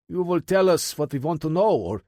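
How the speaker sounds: a Russian thug laying down the law; Russian menace. A narrator's reading. Interrogator threatens Ty, in a hint of Russian accent.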